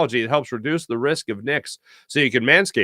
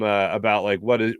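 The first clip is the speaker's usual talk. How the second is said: ordinary talk, nothing marked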